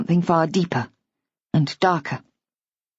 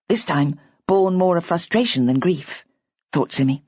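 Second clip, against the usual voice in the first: usual speaking voice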